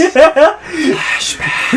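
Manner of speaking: high, drawn-out transition call; mock flashback cue. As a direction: sing out a playful scene change. Playful scene-transition effect.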